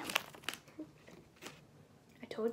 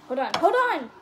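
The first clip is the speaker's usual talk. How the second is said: frantic; 7 semitones higher, 4 dB louder than usual. Real, not acted. Struggling over the candy.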